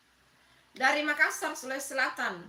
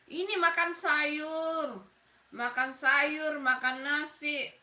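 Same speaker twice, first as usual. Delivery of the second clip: slow; much slower than usual. Real, not acted. Naming food slowly for viewers.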